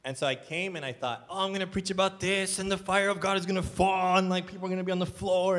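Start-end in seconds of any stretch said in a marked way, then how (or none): 1.3-5.6 high, hyped-up mock preacher zeal; exaggerated eagerness. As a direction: overdo your own big expectations in a raised voice. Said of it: "I'm exaggerating".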